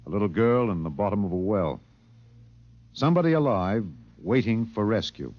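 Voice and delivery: dramatic radio host narration; measured broadcast storyteller. A narrator's reading. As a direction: set the scene like an on-air editor. Narrator continues the show.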